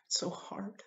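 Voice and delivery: high, whispered, voice giving way; choked up. Real, not acted. Father admitting how hard it has been, choked up. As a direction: barely get the words out through tears. Overcome while telling of infertility.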